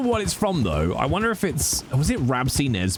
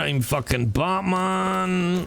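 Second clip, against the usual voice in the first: put-on slow, drawn-out Scottish accent; lingering Scottish superhero voice; Scottish Batman; slower than usual. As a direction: stretch the vowels in mock Scots. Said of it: "Scottish Batman".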